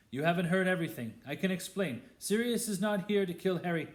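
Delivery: urgently cutting in to be heard; urgent plea. A narrator's reading. Lupin begging Snape to listen, urgent and fast. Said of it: "said Lupin urgently".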